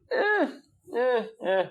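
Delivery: high, drawn-out hesitant eh; squeaky reluctant hemming; hesitant npc. Acted. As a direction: stall with reluctant high noises. NPC hesitates over new recruit.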